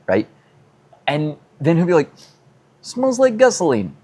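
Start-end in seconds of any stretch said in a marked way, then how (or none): 2.8-4.0 high-pitched mock Russian accent; horrible Russian accent. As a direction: do a bad, high Russian neighbor impression. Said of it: "I do a horrible Russian accent".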